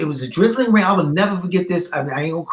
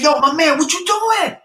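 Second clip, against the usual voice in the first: yell; 9 semitones higher than usual. Retold or imitated. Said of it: "And I yell".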